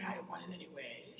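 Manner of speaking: fast sulky whisper; brushed-off whispered line; whispered quote. Retold or imitated. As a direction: whisper it off as if you never cared. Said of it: "you say".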